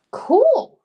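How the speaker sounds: high, loud delighted cool; real delighted exclamation; excited. Real, not acted. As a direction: burst out with delight. Reacting to moon wagon story.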